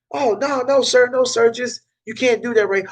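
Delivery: high-pitched mock scolding; mock objector. Neighborhood objector stopping the flyer guy, mock objection. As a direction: lightly act out someone forbidding it, pitched high.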